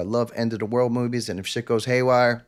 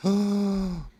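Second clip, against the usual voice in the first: gasp; 8 semitones higher than usual. Retold or imitated. Retelling shock on the phone.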